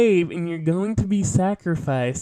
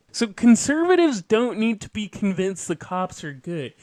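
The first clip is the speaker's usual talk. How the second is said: ordinary delivery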